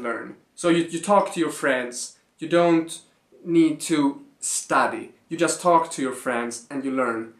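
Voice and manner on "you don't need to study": ordinary talk, nothing marked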